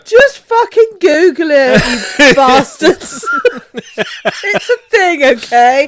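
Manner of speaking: loud, laughing, mock-indignant; laughing outburst. Real, not acted. Co-host defends herself while laughing loudly. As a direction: shout it while cracking up.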